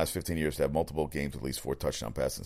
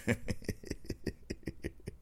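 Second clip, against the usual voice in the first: breathy laugh; 11 dB quieter than usual. Real, not acted. Laughing at the coach quote.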